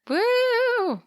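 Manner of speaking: high, happy whoop; a bright celebratory woo; excited whoop. Real, not acted. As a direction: cheer it out. Cheering the new decade.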